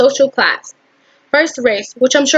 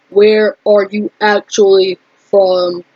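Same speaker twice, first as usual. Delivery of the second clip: lower, slow, emphatic man's voice; more bass; 4 semitones lower, slower than usual. Acted. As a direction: drop the pitch and space out each word heavily. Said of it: "putting in a little bit more bass in his voice".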